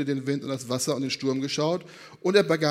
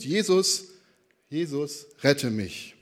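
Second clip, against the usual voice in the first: usual speaking voice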